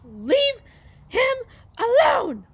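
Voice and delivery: loud, high, slowed yell; angry goose shout. Acted. Old goose drives off the fish, furious high yell. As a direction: bellow it at the fish, stretching alone.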